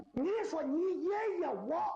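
你爷爷我 pitched high and drawn out slowly; 高调慢拖“你爷爷我”; mocking the wrong emphasis. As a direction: stretch 你爷爷我 high and slow to show the mix-up. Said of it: "这语言这逻辑重音呢".

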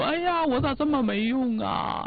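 调门抬高、拖慢，假装委屈自责; 装着哭腔诉苦，一声“哎呀”拉长; 示范哭诉. Shown as a demonstration. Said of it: "你就哭，". (教人装哭示弱，高声慢慢哀叹)

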